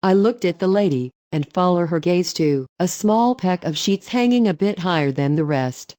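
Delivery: usual speaking voice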